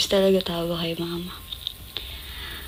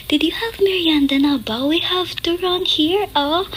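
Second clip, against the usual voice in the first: high airy konyo rich-mom Taglish; 9 semitones higher than usual. Retold or imitated. Neighbor's rich mom chirping about merienda in konyo Taglish. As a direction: put on a breathy posh-tita konyo voice. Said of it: "Konyo, konyo yung mom niya".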